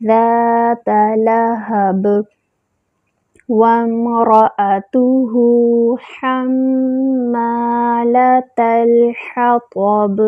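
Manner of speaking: slow drawn tajweed chant; measured recitation; Quran recitation. Shown as a demonstration. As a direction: recite slowly, holding the vowels. Recites verse slowly.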